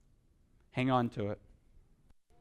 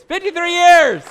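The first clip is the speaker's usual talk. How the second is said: loud proclamation; 12 semitones higher, 11 dB louder than usual. Real, not acted. Preacher to congregation, repeating the couple's answer with a loud proclamation. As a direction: proclaim the number like a triumph.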